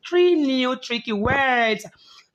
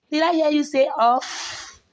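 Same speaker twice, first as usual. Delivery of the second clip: mock surprise; 7 semitones higher than usual. Teacher mocks wrong answer.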